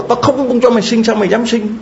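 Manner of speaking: high-pitched acted reprimand; retold scolding. Doctor scolds the woman in labour.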